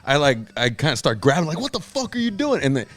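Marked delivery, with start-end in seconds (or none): 1.6-2.6 high-pitched, held-back mock yell; re-enacted indignant challenge; acted outrage. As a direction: confront him in a high, strained voice without volume. Acted confrontation while grabbing the man.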